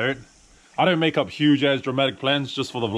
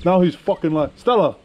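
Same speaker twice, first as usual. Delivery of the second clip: gloating call; 6 semitones higher than usual. Real, not acted. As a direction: crow it and call the dog. Gloating at the dog, calling it.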